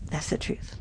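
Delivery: "That's the truth" dropped to a soft breathy whisper; a hushed aside repeating "That's the truth"; whispered echo. Shown as a demonstration.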